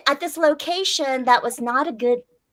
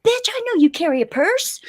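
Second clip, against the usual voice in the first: higher-pitched mock demand; imitated robber; 7 semitones higher than usual. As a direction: lightly act out the insistent mugger. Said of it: "He goes".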